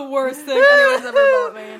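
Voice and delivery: laughing shout. Real, not acted. Laughing shout at gag gift.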